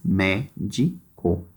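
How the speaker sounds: "médico" slowly split into mé, di, co; three separate beats on "médico", pausing between them; syllable by syllable. Shown as a demonstration. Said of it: "três sílabas".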